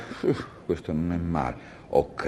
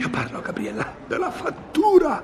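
very high, agitated protest; exasperated outburst; faster than usual. Acted. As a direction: burst out shrieking with exasperation. Exasperated husband confronts wife about bill.

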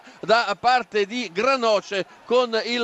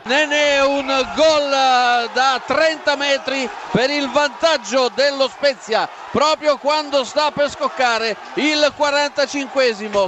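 shouted goal call; 2 semitones higher than usual. Real, not acted. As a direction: roar the scorer's name and the goal. (urlo del gol in diretta)

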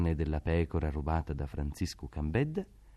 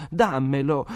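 put-on higher, louder child's demand; spoiled boy reaching out and demanding; spoiled sick boy demands the piglet; demanding shout; 8 semitones higher, 8 dB louder, slower than usual. A narrator's reading. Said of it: "tese le braccia gridando".

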